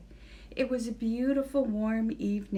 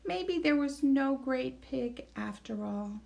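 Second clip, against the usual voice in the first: plain reading voice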